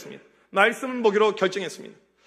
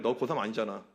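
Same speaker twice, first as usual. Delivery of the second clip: usual speaking voice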